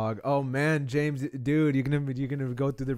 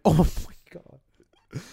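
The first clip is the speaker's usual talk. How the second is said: awed; 5 semitones higher, 7 dB louder, much slower than usual. Retold or imitated. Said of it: "I was like".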